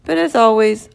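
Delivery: usual speaking voice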